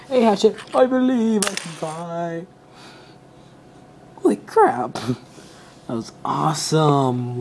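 excited toy robot. Pretend. Toy figure flying in play.

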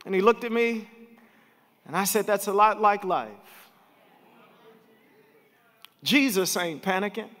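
rising to a shouted climax; preacher lifting the church about Jesus, building to a shout; preaching at full force. Acted. Bring it home, loud and high.

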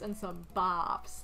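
ordinary delivery

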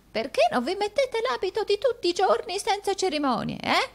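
put-on very high, querulous old woman's voice; old housekeeper. A narrator's reading. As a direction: nag the master, high-pitched. Deaf housekeeper retorts.

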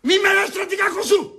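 high, angry outburst; irritated shout. Acted. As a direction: shout down the teasing nickname. Snaps at mocking pet name.

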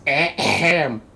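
loud, theatrical "ahem"; mock throat-clearing. Streamer about to read a letter aloud, mock throat-clearing. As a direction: clear the throat loudly for show. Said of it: "I got my reading voice".

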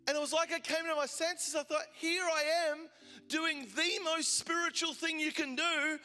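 impassioned sermon delivery; fiery preaching. Acted. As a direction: preach it with rising fire. Preaching at full force.

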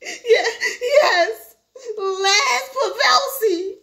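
high, tearful, drawn-out reply; whimpering. Acted. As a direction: whimper the name out slowly. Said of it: "Herbie whimpered".

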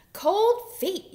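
high, loud duck holler; excited high-pitched yell. A narrator's reading. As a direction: shout it out high. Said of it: "yelled Duck".

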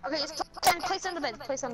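high, loud excited. Real, not acted. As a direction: burst out eagerly. Excited building moment.

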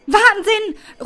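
high, loud cheer of delight; jubilant cry. Acted. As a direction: shout it out in sheer joy. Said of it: "ruft sie jubelnd". (jubelnder Ausruf)

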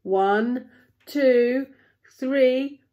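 loud count building in pitch; acted countdown, rising with each number; yelled count. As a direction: count up to a big heave. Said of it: "yelled Timothy".

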